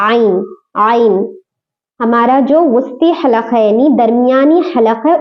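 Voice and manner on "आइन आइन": आइन drawn out slowly from the mid throat; आइन stretched slowly, light not heavy; slow ain drill. Shown as a demonstration. Teacher drilling the throat letter for students. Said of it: "अच्छी तरह से practice करने से आ जाता है".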